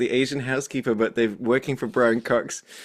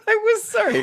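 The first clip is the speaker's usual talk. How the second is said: laughing apology. Real, not acted. Laughing apology after a joke.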